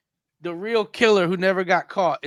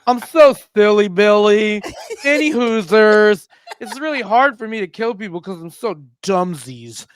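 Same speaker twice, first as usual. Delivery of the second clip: slow, singsong silly reading; mock serial-killer letter voice; goofy killer's letter. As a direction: stretch the words like a cutesy goofball. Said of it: "I'm gonna read it in the voice".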